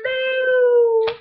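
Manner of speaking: high, loud cry of "No!"; shocked cry. Retold or imitated. Reviewer reliving the episode's twist, with a loud, high cry. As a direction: yelp out a stunned no. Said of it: "and I'm like".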